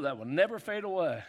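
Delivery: normal talking voice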